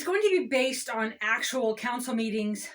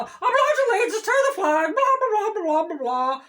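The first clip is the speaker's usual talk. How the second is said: insincere pledge; 7 semitones higher, 5 dB louder than usual. Acted. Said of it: "I don't really mean it".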